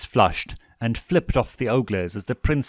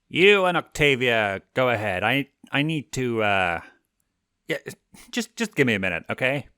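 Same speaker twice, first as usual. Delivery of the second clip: lightly voiced prince, halting; a hint of the prince; 3 semitones higher than usual. A narrator's reading. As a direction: stammer a little, flustered. Stolas voiced, hesitant.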